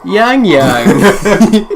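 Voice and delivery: laughing, high and loud. Real, not acted. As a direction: burst out laughing at the name. Laughing at the brand name.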